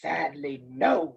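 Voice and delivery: low, dry wolf voice; mock regret from a villain; deep, smug wolf admission; sly wolf. A narrator's reading. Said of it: "said the wolf".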